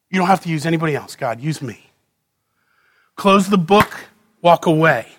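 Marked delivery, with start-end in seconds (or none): 0.1-1.9 fast, then soft and low; quiet prayer. Acted. Preacher voicing a believer pleading with God, fast soft prayer. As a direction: murmur it as a private plea.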